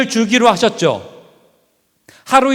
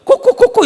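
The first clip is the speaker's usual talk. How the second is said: high, louder imitated clucking; chicken clucking; 7 dB louder than usual. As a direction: cluck away, amused. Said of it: "이러고 있다고요".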